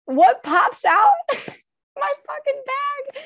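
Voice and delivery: high, laughing disbelief; squealing with laughter at the luggage punchline. Real, not acted. Punchline of funny story.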